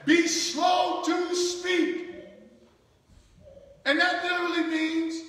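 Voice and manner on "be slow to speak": drawn out, each word weighed; slow, deliberate scripture emphasis. Shown as a demonstration. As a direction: lay it down slowly and higher. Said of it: "notice what he says".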